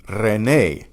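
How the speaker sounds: René said slowly as re-NAY; slow René, stressed at the end; stress on last syllable. Shown as a demonstration. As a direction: land on the final é of René. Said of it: "stressed on that last syllable".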